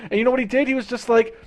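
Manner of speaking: ordinary talk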